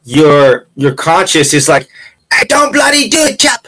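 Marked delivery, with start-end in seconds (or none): 2.3-3.7 high-pitched, hammed-up British voice; mock British accent. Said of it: "How was that accent?".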